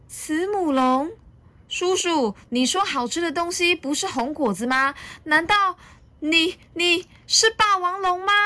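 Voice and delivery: put-on high child voice, rising disbelief; very high, startled storybook youngster; alarmed little dinosaur. A narrator's reading. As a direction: question in mounting shock, stammering.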